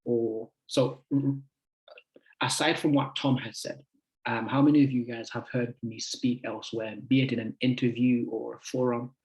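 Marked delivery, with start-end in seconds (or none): none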